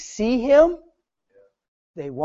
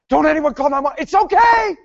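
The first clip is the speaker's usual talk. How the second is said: raised, high-pitched plea to a crowd; acted urgent reassurance, louder at the end; urgent calling out; 6 semitones higher, 3 dB louder than usual. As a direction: call out to head off onlookers, rising to a shout. Calling out to stop onlookers phoning 911.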